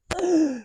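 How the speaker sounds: high sudden intake of breath; sharp horrified gasp. Retold or imitated. Mom hearing the rectal strep result, sharp gasp. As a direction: gasp as the truth lands.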